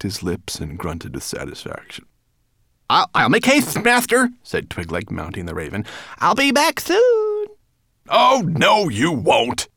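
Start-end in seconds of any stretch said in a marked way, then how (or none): 2.9-4.3 high, loud, flustered servant voice; eager servant. A narrator's reading. Servant Twigleg hurries to obey. 6.2-7.5 very high, loud, drawn-out servant's call. A narrator's reading. Twigleg calls while leaving. 8.1-9.7 high, loud, cross refusal. A narrator's reading. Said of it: "said Nettlebrand crossly".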